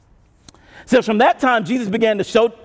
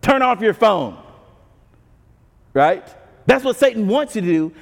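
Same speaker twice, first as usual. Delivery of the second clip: normal talking voice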